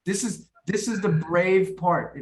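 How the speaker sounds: usual speaking voice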